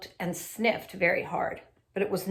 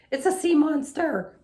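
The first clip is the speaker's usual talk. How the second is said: high, loud terrified cry; panicked shriek; 8 semitones higher, 6 dB louder than usual. A narrator's reading. Frightened lady pointing at the seaweed dog, shrieking. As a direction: scream the warning. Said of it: "she shrieked".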